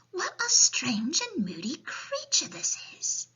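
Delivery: put-on high little girl voice, wondering; high-pitched child's astonishment; Sophie. A narrator's reading. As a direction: marvel as a small girl. Sophie marvels at the giant.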